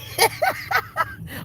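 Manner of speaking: very high, airless wheezing laugh; real giggling wheeze; breathless high laugh. Real, not acted. Laughing at the crying baby joke.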